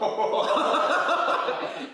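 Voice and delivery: loud burst of high laughter; bursting out laughing. Real, not acted. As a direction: crack up loudly. Laughing at his own story.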